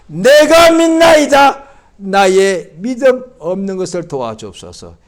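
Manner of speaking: slow, loud, high cry of faith; fervent cry. Retold or imitated. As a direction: cry out your belief with everything. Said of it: "이 사람이 이렇게 말합니다".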